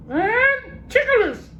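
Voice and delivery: very high slow parrot garbling a long word. Acted.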